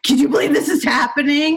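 mock-hollering to an audience; calling out in mock amazement; retold excited cry. Retelling a shout to an audience.

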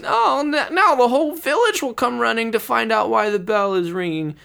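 high, loud fretting; a monk dreading the whole village coming; high alarmed worry; panicked Brother Michael. Acted.

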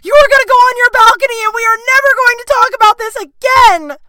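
shrieked high commands, fast; high, loud, rushed ultimatum to the boyfriend; scream. Retold or imitated. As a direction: scream it in a full dramatic panic. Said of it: "And I scream".

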